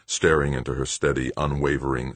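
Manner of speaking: ordinary delivery, nothing marked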